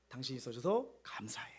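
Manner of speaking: soft, unvoiced whisper to a spouse. Shown as a demonstration. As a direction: murmur thanks intimately to your partner. Model words to say to a spouse.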